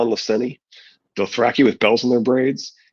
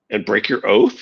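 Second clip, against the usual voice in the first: usual speaking voice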